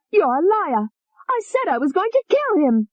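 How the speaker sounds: high, venomous female alter; high hateful young woman; vicious Tony. Acted. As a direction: sneer the threat up high. Tony's voice.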